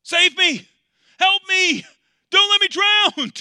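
high, full-force drowning shout; desperate cry for help. Acted. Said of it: "to cry out to Jesus".

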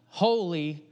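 lightly voiced heavenly cry of worship; seraphim crying out. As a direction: reverent proclamation, understated. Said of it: "the seraphims cry out".

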